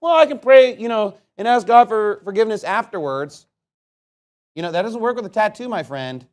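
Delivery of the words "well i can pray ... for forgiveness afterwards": lightly mocking, pitched-up quote; mocking stupid mentality. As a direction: mimic a foolish excuse, higher and louder. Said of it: "this stupid idea of like".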